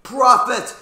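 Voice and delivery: high, loud accusation; anguished cry. A narrator's reading. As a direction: hurl the word at the bird. Confronting the raven in anguish.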